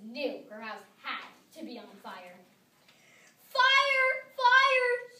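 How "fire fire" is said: very high, very loud scream. A narrator's reading. Missus Wimple screaming that her house is on fire, very high and loud. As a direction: scream the alarm. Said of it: "she screamed".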